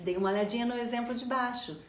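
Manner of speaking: normal talking voice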